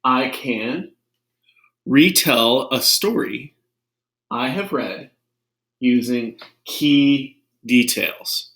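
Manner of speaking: slow, clear teacher read of the goal; slow deliberate classroom voice; slow lesson read. Shown as a demonstration. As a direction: read the objective slowly for the class. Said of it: "So mirrors on".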